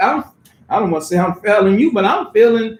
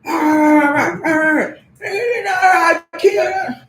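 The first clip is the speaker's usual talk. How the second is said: mock shouting threat; 6 semitones higher, slower than usual. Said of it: "people screaming in my face".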